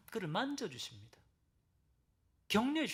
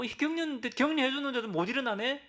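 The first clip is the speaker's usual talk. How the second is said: much higher and louder, sarcastic disbelief; mock exasperation; 10 semitones higher, 6 dB louder than usual. Pretend God fed up with Elijah, high and loud mock sarcasm. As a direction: play an impatient God who gives up on you. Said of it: "하시는 것이 아니라".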